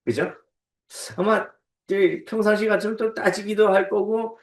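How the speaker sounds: ordinary talk, nothing marked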